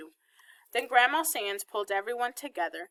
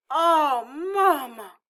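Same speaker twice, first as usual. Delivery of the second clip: loud, high Southern wail of dismay; upset, disappointed, Southern; 6 semitones higher, 7 dB louder, much slower than usual. A narrator's reading. Daughter dismayed by her mother, upset and Southern. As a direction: cry it out in upset Southern tones. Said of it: "Mama sounded real upset and disappointed, and Southern".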